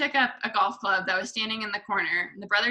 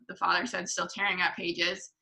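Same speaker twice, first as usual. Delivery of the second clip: ordinary delivery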